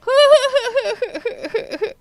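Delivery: loud high cackle, tapering off; hearty laugh. Real, not acted. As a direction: burst out laughing, high and long. Laughing at own joke.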